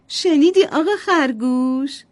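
a mum teasing the toy rabbit; playful mother. Acted.

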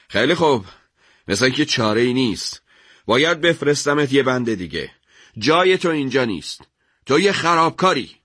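lightly played officer passing sentence; dismissive, with a scornful end; curt sergeant.

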